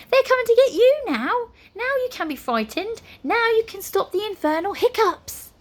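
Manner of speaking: high-pitched, frantic wizard rant; yelling. A narrator's reading. Wizard rants at the hiccuping house, yelling. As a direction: scold the house, voice pitched right up. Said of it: "Flabbergast yelled at the house".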